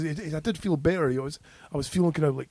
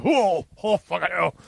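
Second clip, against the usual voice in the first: startled reaction; 2 semitones higher than usual. Retold or imitated. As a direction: act out a shocked gasp and curse. Reacting to the pipe going in.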